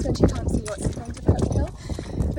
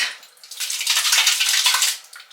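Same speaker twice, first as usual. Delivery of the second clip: loud laugh; 6 dB louder than usual. Real, not acted. Laughing at dinner noise.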